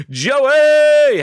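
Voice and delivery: loud, high, drawn-out name; big rising shout of hello; calling out. Real, not acted. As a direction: holler a greeting to a friend. Calling out to arriving viewer.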